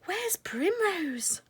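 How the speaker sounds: high, slow alarmed cry; a mother suddenly missing her child; cried out in alarm. A narrator's reading. Lady Woodmouse notices her daughter gone, high alarmed cry. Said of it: "cried out in alarm".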